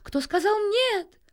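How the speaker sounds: high, slow, flustered question; alarmed thrush. A narrator's reading. Alarmed thrush asks who answered him, flustered high cry. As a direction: demand it in startled dismay. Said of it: "всполошился дрозд".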